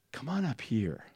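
whispered beckoning; hushed invitation. Retold or imitated. Intern to resisting girl, softly coaxing. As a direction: call her forward under your breath.

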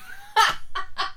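sudden high burst of laughter. Real, not acted. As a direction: crack up at the memory.

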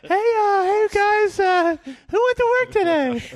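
squeaky falsetto, slow; high pitch. Retold or imitated. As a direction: mimic a cracked high voice. Mocking friend's high voice.

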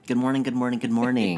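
bright, loud TV host greeting; morning show host. Retold or imitated. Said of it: "I always think of Ann Curry".

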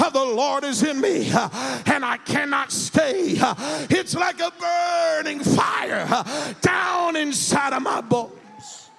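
rising sung preacher whoop, slow and high; preaching at full force. Acted. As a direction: chant the sermon with an uh after each phrase. Whooping preacher tells Jeremiah story.

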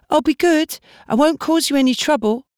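put-on high, pleading young girl's voice; begging girl. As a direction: plead like a desperate teenage girl. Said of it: "she promised".

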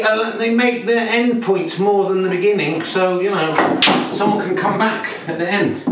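excited sports commentator voice; snooker commentary. Acted. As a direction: call the frame like a TV commentator.

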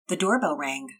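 usual speaking voice

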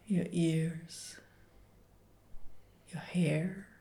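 ordinary talk, nothing marked

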